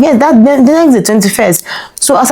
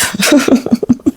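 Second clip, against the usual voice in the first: short laugh; 6 semitones higher than usual. Real, not acted. Laughs at the story.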